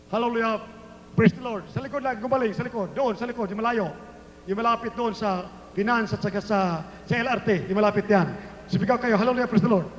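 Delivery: normal talking voice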